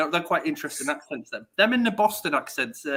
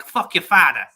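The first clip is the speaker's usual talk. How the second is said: put-on louder TV tough-guy Boston accent; punchy mock Boston accent; New Yorky Boston accent; 7 dB louder than usual. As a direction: imitate the show's New York-Boston talk. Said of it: "like, sort of New Yorky".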